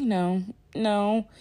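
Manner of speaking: ordinary talk, nothing marked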